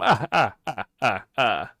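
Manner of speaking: campy Transylvanian ah ah ah; Count laugh. Acted. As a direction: cackle like the Sesame Street Count. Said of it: "What kind of accent is that?".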